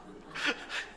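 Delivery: a quiet unvoiced laugh; soft laugh at his own joke; breathy laugh. Real, not acted. Preacher laughing at his own Seoul joke, quiet laugh.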